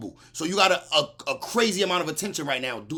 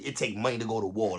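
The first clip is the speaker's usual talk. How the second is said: lower, softer dismissive mimic; mocking quote; 8 semitones lower, 7 dB quieter than usual. Mocking Adam's war line to viewers, muttered scorn. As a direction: toss his words back under your breath. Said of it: "You wildin' talking about something".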